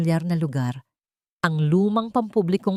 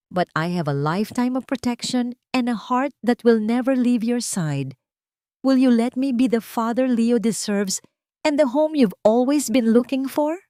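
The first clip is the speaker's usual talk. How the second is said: Ben proposing; 6 semitones higher than usual. A narrator's reading. Ben asks Tessa to marry him, earnest and rising. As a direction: pour his heart out, rising with hope.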